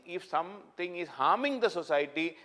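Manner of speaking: ordinary talk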